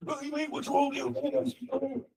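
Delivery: a hammed-up British complaint; angry Brit wrong way. Shown as a demonstration. Lecturer mocking how an angry Brit would not talk, high and slow. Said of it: "they don't say".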